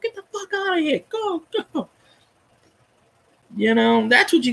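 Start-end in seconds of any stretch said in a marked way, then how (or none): 0.0-1.9 high, softer mock brush-off ending in a pinched, crying tone; imitating Brees ordering off. Brees to the dude on the field, high mock brush-off. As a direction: shoo the player off in a squeaky put-on voice.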